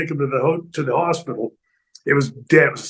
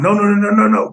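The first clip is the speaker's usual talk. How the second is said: retold protest; 6 semitones higher, 4 dB louder than usual. Retold refusal while being thrown.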